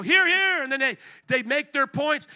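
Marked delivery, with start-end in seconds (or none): 0.0-0.6 loud, high imitated cheer; British Parliament heckler. Members answering whoever stands up, loud imitated call. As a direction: call it out over the chamber. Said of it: "Somebody stands up and then they go".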